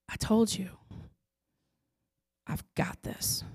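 unvoiced whispered reassurance from God. God answering the mother again, in a whisper. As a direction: breathe it like a secret promise. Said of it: "he goes".

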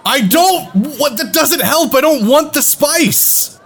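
exasperated. Acted. Player argues loudly in character.

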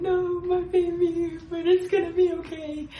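hammed-up weepy parent; mock weeping. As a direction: comfort the child while half-crying. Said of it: "And they're weeping".

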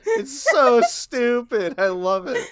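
normal talking voice

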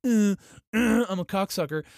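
high, loud dopey mumble; mock dopey voice. Host mocks the Kim family with a dopey voice. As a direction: play a dim-witted dictator. Mocking the Kims in a voice.

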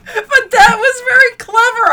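laughing. Real, not acted. Cracking up at a pun.

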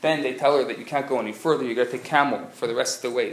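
usual speaking voice

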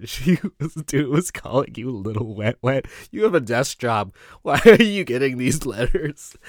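amused disbelief, laughs breaking in; laughing incredulity. Retold or imitated. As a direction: tease a coworker through laughter. Cracking up while teasing coworker.

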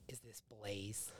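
very soft, low and slow; hushed imitated question. Boyfriend imitating girlfriend on the phone, hushed and slow. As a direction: imitate her asking, almost under the breath.

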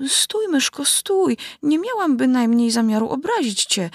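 high pleading call. A narrator's reading. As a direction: call after the mouse, pitched high. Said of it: "wołała dziewczynka".

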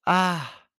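sharp high yelp; boy yelling out after a fall; child's cry of pain. A narrator's reading. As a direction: let out a sudden hurt cry. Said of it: "y gritó".

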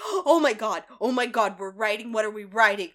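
loud, eager oh my god; excited babble. Retold or imitated. Rumple excitedly asks what they are riding, loud. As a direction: gush like a kid before a ride. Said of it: "Rumple's like".

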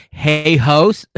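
high, loud, drawn-out hey; hammed-up loud retelling; mock outraged quote. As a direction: blurt it high and loud, imitating an offended sister. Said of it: "Yo, did she just say".

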